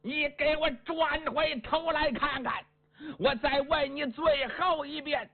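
high call after a retreating man. A narrator's reading. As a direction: call him back, high and pleading. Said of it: "他只能大声喊".

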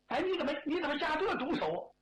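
high, stammered reproach; aggrieved protest. Acted. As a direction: protest the cruel blow, pitched well up. Kicked man protests the blow. (高声委屈地质问)

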